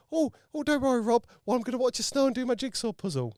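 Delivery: high mimicking voice, way up; mocking Lynn's excuse. Host mimics Lynn's snow-day excuse in a high mocking voice. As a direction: parody her breezy excuse in a high voice. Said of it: "And I was told that".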